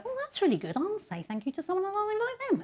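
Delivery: high, light put-on voice; pleased colleague. Colleague discovering the thanks channel, high and pleased. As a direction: sound sweetly impressed in a high mock voice.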